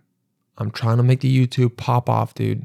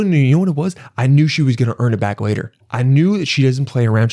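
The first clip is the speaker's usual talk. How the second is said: ordinary talk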